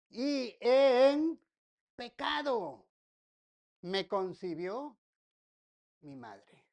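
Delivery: "y en... pecado... me concibió... mi madre" with long pauses, the last words dropping to a murmur; dramatic pauses. Acted. Preacher reading the psalm to his congregation, slow and weighty. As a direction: let each word hang before the next.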